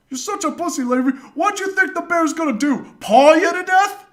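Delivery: high, loud, hammed-up taunt; jeering mockery. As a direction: imitate a derisive friend, building to a shout. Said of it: "he's laughing, and he's just like".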